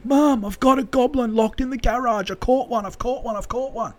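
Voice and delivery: high-pitched, giddy imitation; thrilled caller repeating the news; excited son. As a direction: babble it high, fading as you repeat. Joe calls again, excited.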